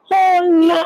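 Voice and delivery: sudden high cry of dismay; pained outcry. Real, not acted. Mother recalling her daughter's hospital crisis, high anguished cry. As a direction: blurt it out high and loud in alarm.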